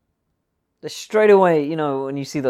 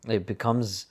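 usual speaking voice

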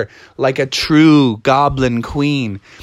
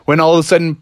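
plain reading voice

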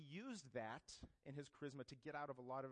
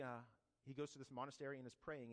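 normal talking voice

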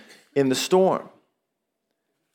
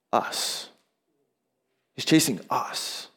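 dropped to a near whisper, slower; a quiet, intimate aside; hushed emphasis; 6 dB quieter, much slower than usual. Acted. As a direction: let it land softly and slowly. Sermon point on being pursued.

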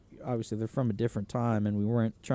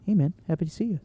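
higher, louder, tiny-mouthed voice; [name] impression; 6 semitones higher, 4 dB louder, faster than usual. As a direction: squeeze it through a tiny mouth. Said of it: "it was my impression of him 'cause he has a really tiny mouth".

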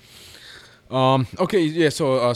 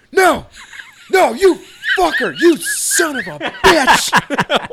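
much higher, much louder, slow shouting; mock yell; 10 dB louder, much slower than usual. Coworker yelling at the Halloween movie, loud mock yell. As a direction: yell at the screen like a scared viewer. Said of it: "he's like".

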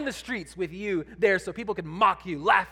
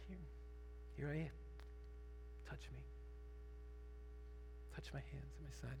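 merciful; 5 semitones lower, 23 dB quieter, faster than usual. Retold or imitated. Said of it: "in his great mercy".

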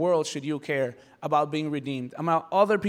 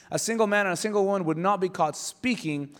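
ordinary delivery

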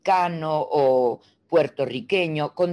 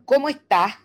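"¿Cómo estás?" higher, Venezuelan style with the S turned into J; Venezuelan accent; 7 semitones higher, 4 dB louder than usual. Pretend. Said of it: "característica número uno de los venezolanos".

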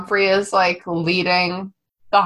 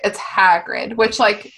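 ordinary talk, nothing marked

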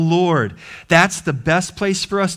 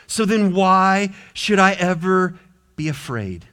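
ordinary talk, nothing marked

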